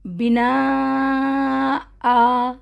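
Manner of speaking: بنا آ with a long drawn آ and no nun; pausal recitation. Shown as a demonstration. Teacher showing the pause on بنا آ. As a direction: stop on بنا and stretch آ for two counts. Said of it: "فرق پڑا؟".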